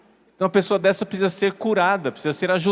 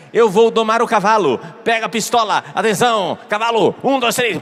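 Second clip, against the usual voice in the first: excited, high mock countdown to firing; raised, punchy voice giving comic orders; mock command; 5 semitones higher, 3 dB louder than usual. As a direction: play an over-eager horse tamer. Mock horse-taming scene, giving orders.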